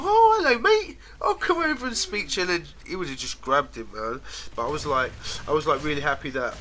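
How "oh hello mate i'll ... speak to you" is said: much higher, louder, cheery dog voice; a dog happily walking into a trap; voicing the other dog. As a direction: greet him like a trusting pal. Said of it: "other dogs would just, like, go".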